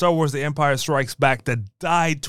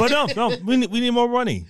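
mock greedy studio; 7 semitones higher, faster than usual.

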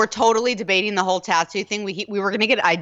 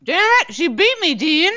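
frustrated yell; 8 semitones higher than usual. Real, not acted. Losing hot dog eating contest.

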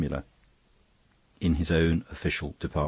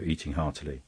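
plain reading voice

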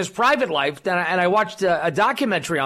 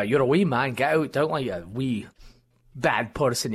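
Scottish brogue; 6 semitones lower, 4 dB quieter than usual. Acted. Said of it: "my Scott brogue".

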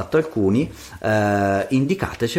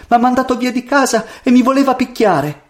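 put-on high, plaintive girl; tearful; 13 semitones higher, 3 dB louder than usual. A narrator's reading. Crying girl telling the prince of her mother, tearfully. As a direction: lament it in a high, hurt voice. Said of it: "perché piangeva tanto".

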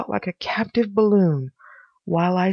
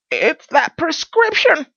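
high, loud, drawn-out effort; panting Pycraft; 10 semitones higher, 5 dB louder, slower than usual. A narrator's reading. Pycraft clambering down the wall, panting. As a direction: strain it out high, as if struggling. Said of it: "he panted as he did so".

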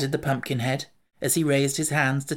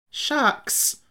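a sharp, much higher scoff of indignation; higher-pitched indignant retort, not shouted; 12 semitones higher than usual. Acted. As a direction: brush it off as an offended boy. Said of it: "returned Tip indignantly".

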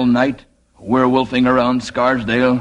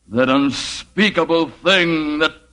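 slow build to a much higher shouted "man"; horror climax shout; 10 semitones higher, much slower than usual. Acted. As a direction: rise slowly into a shrieking horror-movie cry. Werewolf's dramatic horror confession.

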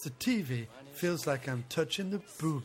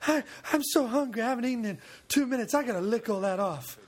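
higher, eager mock action star; comic impression, pitched up; Steven Seagal; 4 semitones higher, faster than usual. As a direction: blurt it as a greedy tough guy. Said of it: "Cigar was like".

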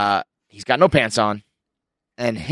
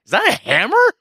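high, louder-pitched startled question; mock police disbelief, pitched way up; incredulous police officer; 14 semitones higher, 4 dB louder than usual. Police to two men at the door, incredulous.

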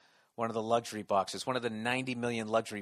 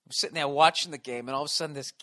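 ordinary talk, nothing marked

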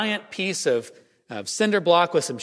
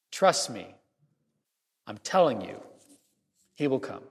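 quiet assurance; 5 semitones lower, 5 dB quieter than usual. Acted. Preacher quietly assures his flock, soft and low. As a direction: drop low and soft, confiding.

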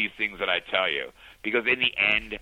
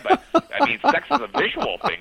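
excited insistence; 4 dB louder, faster than usual. Real, not acted. Excited loud high insistence.

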